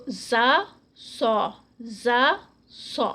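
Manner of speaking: زا buzzing and light, سو heavy and whistling. Shown as a demonstration. Teacher drilling زا against سو. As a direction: alternate زا and سو. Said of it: "سو heavy ہوگا".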